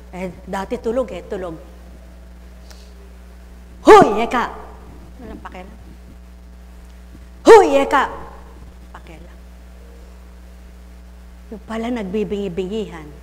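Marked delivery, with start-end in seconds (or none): 3.9-4.4 sudden loud hail; retold shout for attention; calling out. Calling someone who ignores him. 5.2-5.8 very soft, lower drop; barely-voiced shrug of a remark; indifferent aside. The one called takes no notice. 7.5-8.1 sudden loud hail; retold shout for attention; calling out. Calling again, still ignored. 8.9-9.4 very soft, lower drop; barely-voiced shrug of a remark; indifferent aside. Still no notice taken.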